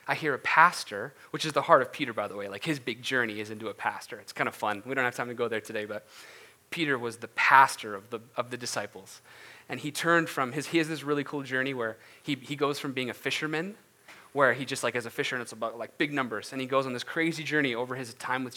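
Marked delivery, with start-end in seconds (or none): none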